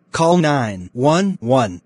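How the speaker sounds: usual speaking voice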